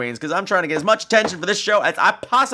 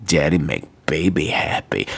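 very low, creepy purr; mock-sinister low pleading; creepy daddy voice; 12 semitones lower than usual. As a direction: drop the voice right down, creepily coaxing. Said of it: "I just creeped myself out".

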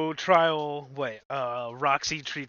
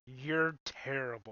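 slow, soft deadpan verdict; understated, stretched-out put-down; Taye Diggs; 3 semitones lower, 8 dB quieter, slower than usual. Retold or imitated. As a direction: draw it out as a damning judgement. Acting out Taye Diggs's blunt verdict.

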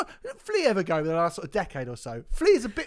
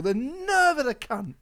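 usual speaking voice

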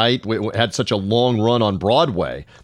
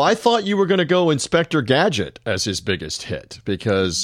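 higher-pitched mock surprise, teasing the co-host; sarcastic; 6 semitones higher than usual. Real, not acted. Co-host teasing partner about the actor's biggest hit, sarcastic. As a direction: say it sarcastically, feigning a mix-up. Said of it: "I'm being sarcastic".

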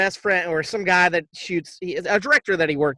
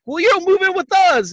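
very high, loud burst; hammed-up high-pitched insistence; mock-eager invitation; 11 semitones higher, 5 dB louder than usual. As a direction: blurt it like an overexcited movie character. Men's eager reply, acted out.

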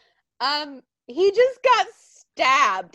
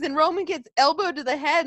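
ordinary delivery

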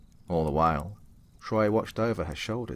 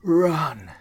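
all-out desperate yell, much higher and louder; 11 semitones higher, 12 dB louder than usual. Acted. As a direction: scream it with everything. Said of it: "yelling to Troy as loud as she could".

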